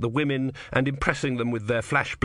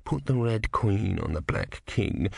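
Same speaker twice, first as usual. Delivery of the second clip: low, slow voice over the shoulder; an unasked adviser, slow and lower; person behind you; 4 semitones lower, slower than usual. A narrator's reading. Someone behind a card player advising, low and slow. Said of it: "like the person behind you who says".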